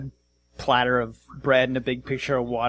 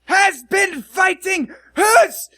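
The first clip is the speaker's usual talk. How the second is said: furious dwarf bellowing, high and loud; angry dwarf captain; 8 dB louder, much slower than usual. Acted. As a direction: roar like an outraged dwarf. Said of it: "dwarf dressed in chainmail".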